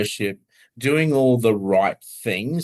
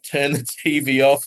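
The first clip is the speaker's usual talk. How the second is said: ordinary talk, nothing marked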